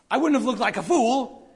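the caught-out planner protesting he looked like a fool; intense embarrassed host. Retold or imitated. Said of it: "he might have had that tone of voice".

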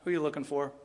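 soft sweet Jesus voice; gentle Jesus. Shown as a demonstration. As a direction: ask it gently, like comforting someone. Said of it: "I don't think he had a scowl".

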